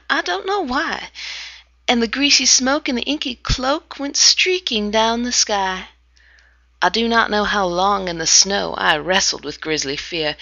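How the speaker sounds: ordinary delivery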